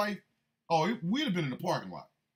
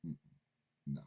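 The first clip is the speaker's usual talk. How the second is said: ordinary talk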